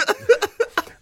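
high squealing laugh; burst of laughter. Real, not acted. Telling the Costa story, high squealing laugh. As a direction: crack up at the punchline.

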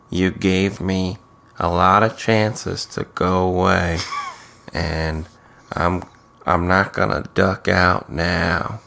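low, slow, hushed imitation of earnest reassurance; mock-tender, low and measured; soft comforting voice. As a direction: play the pouty consoler, quietly. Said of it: "soft, like, pouty, comforting voice that he always does".

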